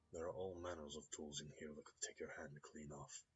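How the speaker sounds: put-on quiet, light Russian accent on "don't touch anything"; the mechanic's polite warning in a light Russian accent. The mechanic warns the neighbor, softly, in a Russian accent. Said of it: "hint of a Russian accent".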